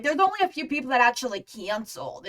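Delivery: normal talking voice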